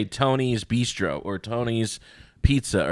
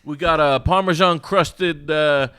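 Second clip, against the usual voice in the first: high, slow hammed-up sales pitch; over-the-top menu pitch; slow mock pitchman; 6 semitones higher, 3 dB louder, slower than usual. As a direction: savor each dish like a showy chef.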